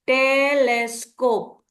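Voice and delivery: "टेलेस्कोप" slowly broken into टे-लेस-कोप; syllable by syllable. Shown as a demonstration. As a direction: sound out "टेलेस्कोप" in three drawn-out syllables. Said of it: "break करके जब पढ़ेगा".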